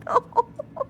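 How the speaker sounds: very high, tearful happy squeal; overwhelmed, happy and upset at once; freaking out. Real, not acted. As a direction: squeal through laughter, close to crying. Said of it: "I'm freaking out".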